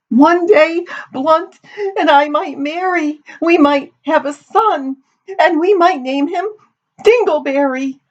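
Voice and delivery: high, hammed-up tearful wail; weeping. A narrator's reading. Daughter imagines her future son to her mother, weeping. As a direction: sob through it in a very high voice. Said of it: "Amity sat weeping".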